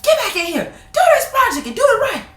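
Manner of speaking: high, loud scolding; hammed-up nagging grandma; high shrill-free mock scolding; mock scolding grandmother. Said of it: "she was like".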